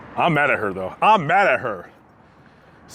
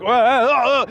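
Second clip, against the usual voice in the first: high, startled uh-uh-uh; startled stammer; 8 semitones higher than usual. Retold or imitated. Rider lifted onto backboard without warning, startled. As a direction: sputter as you're lifted. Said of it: "And I'm like".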